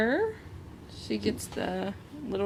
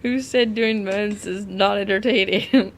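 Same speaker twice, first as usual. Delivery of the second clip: laughing; 8 dB louder than usual. Real, not acted. Laughing after spill, joking.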